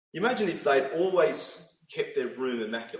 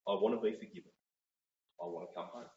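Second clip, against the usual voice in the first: quiet confession; 7 semitones lower, 8 dB quieter, faster than usual. Acted. Voicing a sinner's confession.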